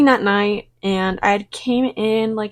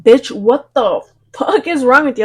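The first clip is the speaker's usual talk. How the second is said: raised, louder angry retort; 4 semitones higher, 6 dB louder than usual. Retold or imitated. Cashier yells at creepy older customer, angry and loud. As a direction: snap back at the creep, loud.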